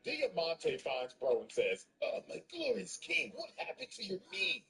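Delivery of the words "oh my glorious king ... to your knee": high fawning voice; fawning servant. Retold or imitated. Diamante fawns over the hurt boss, high fawning voice. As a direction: gush it up high like a groveling servant.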